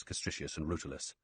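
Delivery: ordinary delivery, nothing marked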